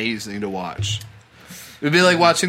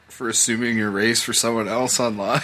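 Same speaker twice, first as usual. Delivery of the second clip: ordinary delivery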